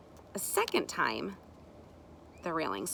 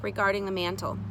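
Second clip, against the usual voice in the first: plain reading voice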